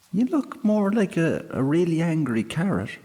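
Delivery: put-on high, quick young girl; girl doubting the fairy's fierceness; cheeky girl. A narrator's reading. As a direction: tease him lightly. Girl insults sprite.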